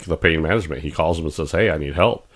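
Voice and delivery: normal talking voice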